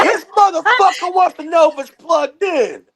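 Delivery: mother complaining about the agent to her son; indignant mother. Retold or imitated. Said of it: "She goes".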